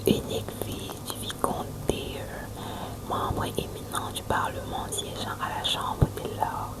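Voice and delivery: plain reading voice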